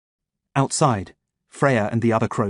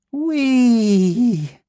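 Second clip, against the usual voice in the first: giddy whee; 12 semitones higher, 4 dB louder than usual. Acted. Delirious giddy cry.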